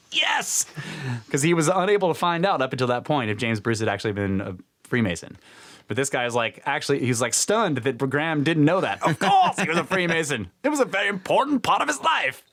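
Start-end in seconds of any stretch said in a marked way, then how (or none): 0.1-0.7 a high, thrilled yelp; sudden gleeful cry; triumphant yes. Retold or imitated. Graham's triumphant reaction retold. 9.0-12.4 put-on a lordly old man, laughing through the words. Baron voiced again in retold story.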